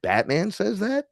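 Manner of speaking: can't believe what he heard; incredulous "Batman says that?"; surprised disbelief. Retold or imitated. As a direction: pitch jumps high in disbelief. Disbelief at the movie line.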